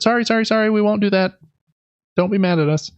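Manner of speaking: usual speaking voice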